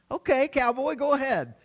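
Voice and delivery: high, amused "okay, cowboy"; playful parental mock-challenge; teasing challenge. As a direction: dare him with a grin in the voice. Parent teasing a boasting kid.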